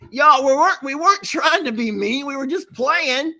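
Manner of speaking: high-pitched defensive plea; protesting. Real, not acted. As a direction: plead innocence to your accusers. Protesting to viewers calling them mean.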